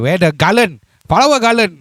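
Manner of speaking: loud, high call for the garland; mock ceremonial call. Host welcoming honoured guest with garlands, mock ceremonial call.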